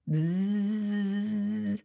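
soft imitated buzz; buzzing mosquito. A narrator's reading.

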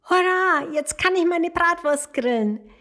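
high, loud, happy cheering; jubilant cheer. Acted. Pony thanking the little dragon, high and jubilant. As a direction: burst out in joy, then settle on the thanks. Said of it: "jubelte das Pony".

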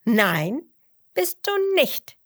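loud fox retort, jumping up high; cheeky shout. A narrator's reading. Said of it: "rief der Fuchs frech". (freches, hohes Rufen)

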